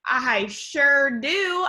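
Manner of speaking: usual speaking voice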